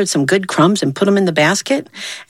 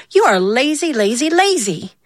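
scolding; 6 semitones higher than usual. Acted. Zia scolds Hoppy once more.

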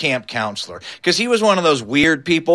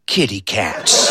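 slow, low, hushed wonder; innocent drunk; 10 semitones lower, slower than usual. Acted. Wally looks down at the skunks, drunken innocence. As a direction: marvel slowly like an innocent drunk. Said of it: "with all the innocence of a drunk".

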